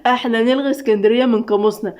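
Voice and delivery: ordinary delivery, nothing marked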